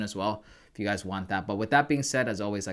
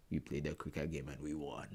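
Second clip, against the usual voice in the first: father-in-law impression; 9 semitones lower, 13 dB quieter than usual. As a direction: play it stoic and deep, unhurried. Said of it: "he'll come back and be like".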